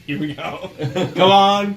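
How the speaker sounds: normal talking voice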